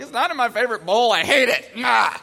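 mock whiny protest ending in a yell; mock tantrum. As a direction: act out a fed-up kid rejecting a treat. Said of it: "everything's wrong".

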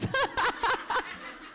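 high embarrassed giggle. Real, not acted. Guest laughing about the surprise podcast, high giggle. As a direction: giggle at yourself.

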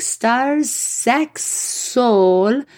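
Italian guide reading the podcast title aloud; Italian man reading. Retold or imitated. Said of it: "He's like".

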